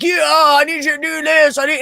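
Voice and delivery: high-pitched, blustering mock yell. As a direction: imitate a bossy guy hollering orders. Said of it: "he was yelling at my husband".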